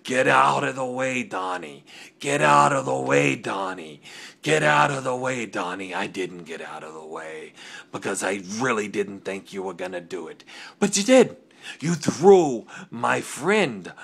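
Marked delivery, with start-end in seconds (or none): 0.0-5.9 retold yelling. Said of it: "Chris is yelling at me". 10.8-13.9 sudden high, loud shout; angry outburst. Acted. Angry promo accusation.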